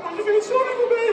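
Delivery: high, loud pained wail; hammed-up hurt voice; mock self-pity. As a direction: wail it for comic sympathy. Self-pity after being hit.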